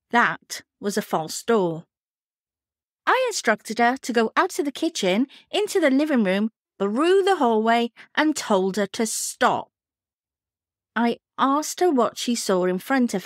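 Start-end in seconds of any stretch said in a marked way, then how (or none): none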